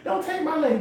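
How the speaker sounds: a lightly voiced frightened farmer. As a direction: play the scared landowner, hammed up. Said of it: "he's like".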